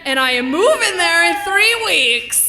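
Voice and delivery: loud, high, ringing declaration; triumphant shout. Acted. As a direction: proclaim it to the whole room, drawing out key words. Triumphant announcement closing the act.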